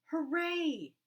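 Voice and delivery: high, loud happy cheer; excited cheer. Acted. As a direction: cheer like thrilled little chicks. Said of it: "they cried".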